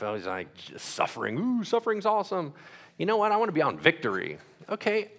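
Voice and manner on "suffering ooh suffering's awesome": mocking sarcasm. Mocking pastor's message.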